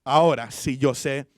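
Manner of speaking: ordinary delivery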